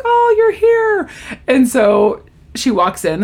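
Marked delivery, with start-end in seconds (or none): 0.0-1.1 very high, drawn-out mock welcome; gushing greeting. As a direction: coo it slowly, pitch way up. Friends greet arrival with baby.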